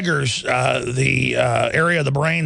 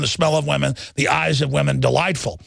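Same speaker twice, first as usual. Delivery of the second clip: usual speaking voice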